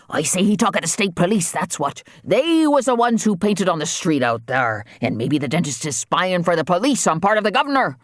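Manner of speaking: high-pitched accusing waterman; suspicious, riled islander. Acted. Voices suspicious islander father.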